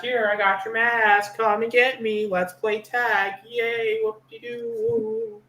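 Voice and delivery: high mock-childish teasing; sing-song mock glee; playful taunt. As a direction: taunt like a kid starting a game of tag, stretching the last words. Retold scene as a childish tease.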